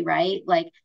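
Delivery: ordinary talk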